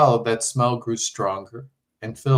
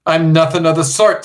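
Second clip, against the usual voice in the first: indignant; 5 semitones higher, 8 dB louder than usual. Acted. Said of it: "stamping her foot".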